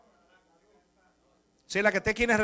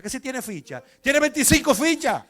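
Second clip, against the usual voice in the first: louder, higher exasperated shout; shouting captain; 7 semitones higher, 10 dB louder than usual. Retold or imitated. Captain shouting that the thief has many records, loud. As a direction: yell it in disbelief.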